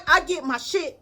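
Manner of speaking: usual speaking voice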